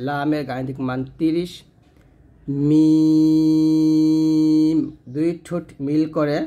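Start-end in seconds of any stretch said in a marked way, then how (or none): 2.7-4.8 মিম louder and held long on closed lips; Arabic letter mim. Shown as a demonstration. Teacher showing students মিম with closed lips, long and loud. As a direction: hum মিম out long with the dry lips pressed. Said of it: "দুই ঠোঁটের শুকনো ... মিমটা উচ্চারণ করবেন".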